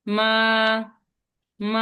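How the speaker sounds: level first tone on মা. Shown as a demonstration. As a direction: hold মা flat and level, no rise or fall. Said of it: "এটা flat আকারে যাবে". (প্রথম টোনে সমান সুরে মা)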